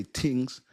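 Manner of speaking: "things" with a hard T for the th; Jamaican "tings". Shown as a demonstration. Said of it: "when Jamaicans say".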